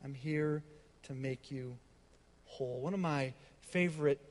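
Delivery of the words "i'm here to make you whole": soft, lower, hushed promise; preacher quoting God's whisper of love. Acted. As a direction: whisper each word gently.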